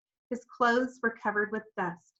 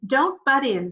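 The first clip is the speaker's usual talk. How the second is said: sharp, loud snap, pitched up; snapped; 5 semitones higher, 6 dB louder than usual. A narrator's reading. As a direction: cut her off hard. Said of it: "snapped Henry".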